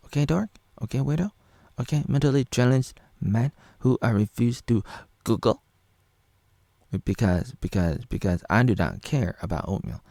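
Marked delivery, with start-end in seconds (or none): 0.1-5.6 much higher, squeaky taunting voice; sing-song a childish mock taunt. Vlogger mimicking Flopper's taunt, squeaky and high.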